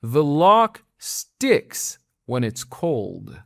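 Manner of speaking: slow, each word apart; very slow model line. Shown as a demonstration. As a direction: lay it out slowly for learners. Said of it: "وقتی هوا سرده". (خیلی آهسته و شمرده)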